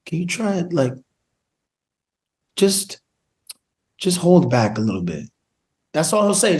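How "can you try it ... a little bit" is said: sparse note with long pauses; quiet-storm director. Retold or imitated. Director giving the actor a small note, quiet storm. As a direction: imitate an understated director, halting between words. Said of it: "it's like the quiet storm".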